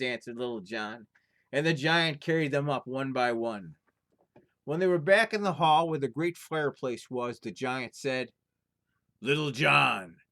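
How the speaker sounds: lively storyteller reading a folktale aloud; story narration. A narrator's reading. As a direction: tell it like a fireside tale, full of drama. Reads folktale aloud to viewers.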